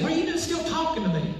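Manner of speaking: high, quick acted question; puzzled questioning. Acted quote of puzzled people.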